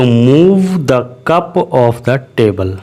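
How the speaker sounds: very slow, every word laid out; slow model sentence. Shown as a demonstration. Teacher models the English sentence slowly for students. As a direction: dictate it at half speed. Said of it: "मूव कहेंगे".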